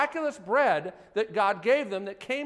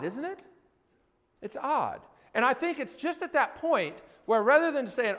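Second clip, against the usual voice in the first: ordinary delivery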